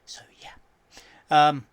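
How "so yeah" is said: whispering. Real, not acted. Trailing off.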